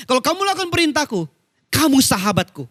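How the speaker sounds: quick, high build, then a landing; emphatic preaching. Real, not acted. Verse repeated forcefully for emphasis. (ayat diulang cepat dengan nada tinggi)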